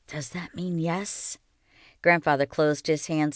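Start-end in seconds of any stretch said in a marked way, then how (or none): 0.1-1.4 soft, slow hushed question; whisper. A narrator's reading. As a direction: ask it barely above a breath. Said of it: "in a whisper".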